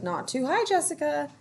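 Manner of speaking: put-on a child calling gently to a friend; Ruthie's voice. A narrator's reading. Ruthie voiced talking to Jessica.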